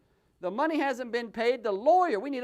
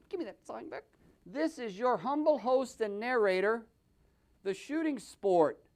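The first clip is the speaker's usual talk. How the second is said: normal talking voice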